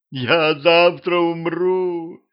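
high, drawn-out howling wail. A narrator's reading. Vampire girl facing execution, high howling wail. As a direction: howl it slowly on a held note. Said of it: "подвывала вампирша".

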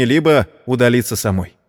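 ordinary delivery

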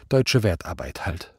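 usual speaking voice

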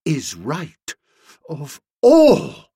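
choked start swelling high and loud; strangled voice. A narrator's reading. Nobby concurs about Boko, strangled with outrage. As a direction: force the words out, near bursting. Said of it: "speaking in a strange, strangled voice".

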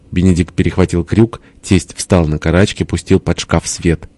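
ordinary delivery

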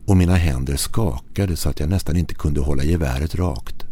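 ordinary delivery, nothing marked

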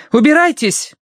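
high, loud shout. A narrator's reading. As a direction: yell at the rats to go. Shouting rats away. (крик)

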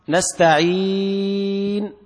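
نستعين with the ii held long, very slow, lips rounding at the end; long madd on نستعين closed with ishmam; four-count madd with ishmam. Shown as a demonstration. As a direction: chant نستعين in four beats, then round the lips silently. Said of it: "بحركتين أو أربع أو ست".

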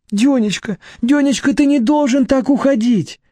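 high, urgent wifely begging; desperate pleading. Acted. Wife begging her husband not to walk out, desperate. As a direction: beg him to stay, pitched high.

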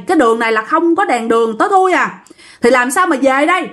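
normal talking voice

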